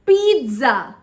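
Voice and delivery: PIZZA as loud "peet-za"; correct pronunciation. Shown as a demonstration. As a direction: punch pizza with a stop before Z. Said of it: "It is".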